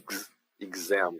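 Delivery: "exam" slow, the X said as G plus Z; "gz, exam" spelled out slowly with the G-Z sound; slow demo. Shown as a demonstration. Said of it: "o X aqui fazendo som de gz". ("exam" devagar, com o X soando como gz)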